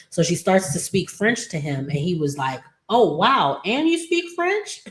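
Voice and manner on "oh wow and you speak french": mock amazement. Man hears the maid speak French, hammed-up astonishment. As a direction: voice leaping very high into the question.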